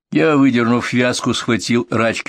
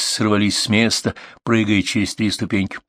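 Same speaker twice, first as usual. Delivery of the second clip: ordinary delivery, nothing marked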